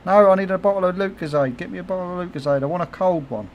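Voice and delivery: mock-whine like his craving wife; insistent wife. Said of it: "she'd go".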